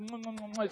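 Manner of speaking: soft smacking mock kisses; kissing sounds. Pastor pretending to kiss Ronnie's toilet, soft smacking kisses. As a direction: blow three playful mock kisses. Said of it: "die ga ik echt kussen".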